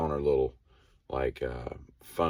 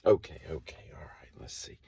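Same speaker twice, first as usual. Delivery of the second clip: whispered, under her breath; whispering to herself; 9 dB quieter than usual. Retold or imitated. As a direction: mutter it to yourself in a whisper. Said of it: "so she's like".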